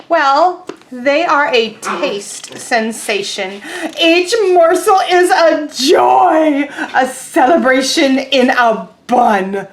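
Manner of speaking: slow, loud, rapturous pigeon; grandly describing pigeon. Acted. Teacher voicing Pigeon praising hot dogs loudly to the duckling. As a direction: savour every word like a food critic.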